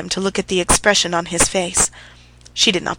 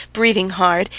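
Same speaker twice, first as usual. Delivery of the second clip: usual speaking voice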